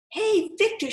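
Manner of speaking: high, friendly call to a friend on the bench; bright call. Acted. Kendra calling out to lonely Victor on the bench, bright and high. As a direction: lift it up high and sweet, like a kind little girl.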